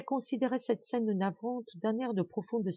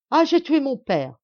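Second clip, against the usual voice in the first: loud, high cry of guilt; son's sudden loud outburst of despair; anguished cry; 8 semitones higher, 10 dB louder than usual. A narrator's reading. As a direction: cry it out in horror. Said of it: "s'écria-t-il".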